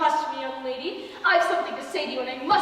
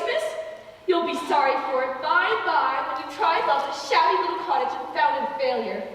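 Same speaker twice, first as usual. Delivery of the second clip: ordinary delivery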